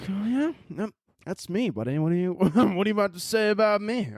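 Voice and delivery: a hint of a bristling listener, high and challenging; mock-defensive listener. Offended listener sitting up to confront him, mock defiance. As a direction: put on a touchy, squaring-up voice.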